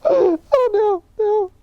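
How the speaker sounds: high, loud, wailing through laughter; real laughing-crying protest; helpless with laughter. Real, not acted. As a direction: wail it in tears of laughter.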